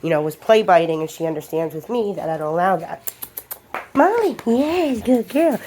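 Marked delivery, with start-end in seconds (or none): 3.9-5.6 high, slow sing-song praise for the dog; sweet dog praise. Real, not acted.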